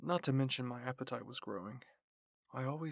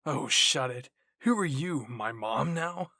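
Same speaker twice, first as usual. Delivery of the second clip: loud, irritated retort; Jean snaps back at Aaron for mothering him, loud and irritated; snapping back; 11 dB louder, slower than usual. A narrator's reading. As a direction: bite back hard at the nagging. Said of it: "Jean growled and snapped back".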